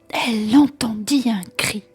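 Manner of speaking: ordinary delivery, nothing marked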